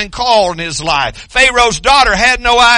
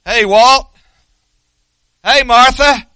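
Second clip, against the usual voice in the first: raised, mild calling by name; crying out; 4 semitones higher than usual. Acted. God crying out listeners' names, mildly raised call. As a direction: call out as if hailing someone. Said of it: "He's crying out".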